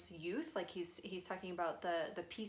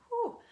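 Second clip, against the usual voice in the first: energy rush whoosh; 8 dB louder than usual. Real, not acted. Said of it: "my spine is like".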